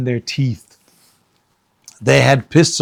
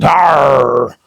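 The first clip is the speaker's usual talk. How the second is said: a mild loud growl; lightly voiced pirate growl; 5 dB louder than usual. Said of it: "the pirate just growled".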